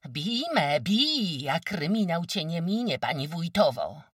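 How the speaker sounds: high, loud taunting yell; jeering shout. Acted. Kozłowa, hands on hips, taunting shout. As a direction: goad her to hit you. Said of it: "krzycząc urągliwie".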